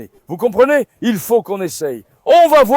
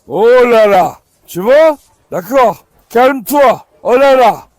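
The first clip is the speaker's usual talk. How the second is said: loud calming; 3 semitones higher, 8 dB louder, slower than usual. Real, not acted. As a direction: raise the voice to calm them. Calming agitated dogs. (appel fort pour calmer les chiens)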